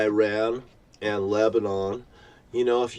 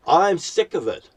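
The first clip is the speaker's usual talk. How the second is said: higher, louder, spat out in anger; angry outburst; 6 semitones higher, 4 dB louder than usual. Real, not acted. Ranting at the world, fed up, louder outburst. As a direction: burst out fed up.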